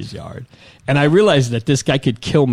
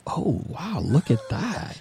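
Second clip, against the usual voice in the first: mock gawking; 5 semitones higher, 7 dB quieter, much slower than usual. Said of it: "I wasn't like".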